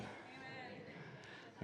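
breathless whispered amen. Real, not acted. Congregation response in whisper.

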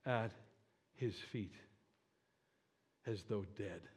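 low, soft and halting, with long pauses; hushed scripture reading. Acted. Preacher reads John falling at Jesus' feet, hushed scripture reading. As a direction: let the voice sink away in awe.

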